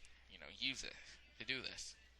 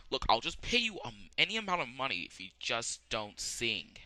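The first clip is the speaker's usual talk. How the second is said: desperate bribe; 9 dB louder than usual. Retold or imitated. Kaiba offers Joey money to stop, loud and desperate. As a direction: plead loudly with money.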